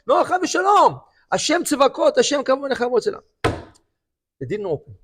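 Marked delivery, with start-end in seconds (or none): 0.1-3.2 fervent denial. Retold or imitated. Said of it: "Solomon answers the gatekeepers".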